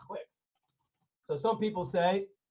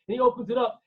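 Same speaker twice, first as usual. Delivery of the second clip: ordinary delivery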